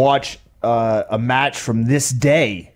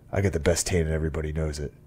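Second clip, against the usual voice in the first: pissed-off wrestler; 8 semitones lower, 10 dB quieter, much faster than usual. Retold or imitated. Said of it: "He's just like".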